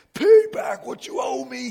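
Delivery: loud, slow furious demand for repayment; demanding shout. A narrator's reading. Servant shouting at his debtor to pay him back. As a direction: shout it, choking him for the money. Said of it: "he demanded".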